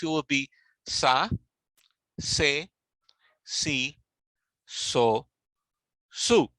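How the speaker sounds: sa, se, si, so, su spelled out one by one; slow paced drill sa se si so su; syllable drill. Shown as a demonstration. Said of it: "in the use with the vowels".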